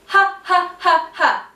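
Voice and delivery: high loud pushed ha ha; forceful voiced ha. Shown as a demonstration. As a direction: push each ha out with force. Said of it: "you're gonna work through".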